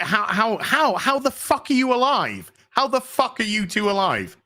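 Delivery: high, stammering astonished question; can't believe they're alive; stunned, high-pitched disbelief. Retold or imitated. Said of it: "it's just like".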